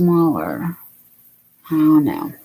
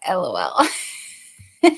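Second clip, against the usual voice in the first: slow laughing read; 8 semitones higher, much slower than usual. Real, not acted. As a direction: laugh through it. Laughs reading comment.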